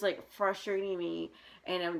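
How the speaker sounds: usual speaking voice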